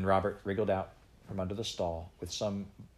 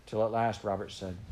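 ordinary delivery

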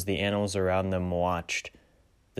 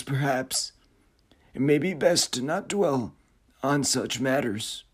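brooding uncanny figure, musing aloud; eerie otherworldly voice; 8 semitones higher than usual. Acted. Frederick's opening monologue.

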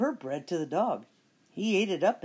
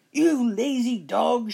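slow, loud, high witch shout; 6 semitones higher, 7 dB louder, slower than usual. Acted. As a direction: bellow it slowly, witchy. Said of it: "shouted Bony Legs".

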